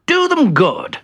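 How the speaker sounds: high, loud and hearty; hearty exclamation. Acted. Bertie urging the carol outing, high hearty exclamation. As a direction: say it out boldly, high-pitched. Excited character calling out.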